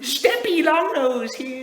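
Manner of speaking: put-on high, slow kids' YouTuber greeting; YouTuber intro voice. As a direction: open like a kids' channel host.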